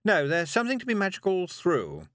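high, teaching witch. Acted. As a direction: lecture high and brisk. Witch reply.

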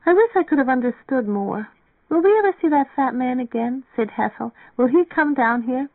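put-on high-pitched wistful girl voice; sleepy girl wondering, pitched high; wistful girl character. A narrator's reading. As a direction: lift the pitch well up for her questions. Voices sleepy Mary Ann, wistful.

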